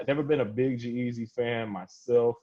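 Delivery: ordinary talk, nothing marked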